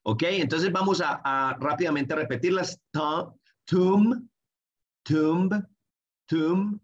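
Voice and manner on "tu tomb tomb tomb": ordinary delivery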